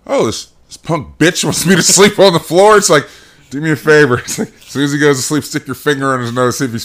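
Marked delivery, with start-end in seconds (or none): 0.1-4.2 put-on higher, louder, conspiratorial kid voice; lightly voiced sneaky child; mock scheming kid. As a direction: plot revenge like a cheeky kid. Voicing the kids.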